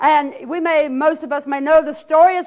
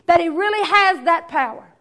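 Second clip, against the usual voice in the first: ordinary talk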